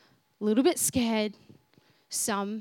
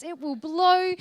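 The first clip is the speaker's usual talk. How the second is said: ordinary talk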